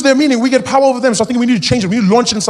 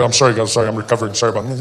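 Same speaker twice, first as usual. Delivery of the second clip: ordinary talk, nothing marked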